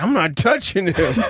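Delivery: high, loud mock-alarmed refusal; spooked refusal. Dad refuses mom's request to pray, spooked refusal.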